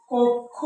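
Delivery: কক্ষ said as "kok-kho"; demonstrated pronunciation. Shown as a demonstration. Teacher showing how kkha sounds at word end, কক্ষ as "kok-kho". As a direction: close the first syllable of কক্ষ with k, then "kho". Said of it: "এটার উচ্চারণ হবে".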